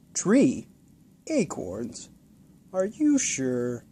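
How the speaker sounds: put-on slow, lightly elderly questioning; doubtful old man. Old man doubting the boy's story, mild elderly voice. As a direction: doubt each word gently.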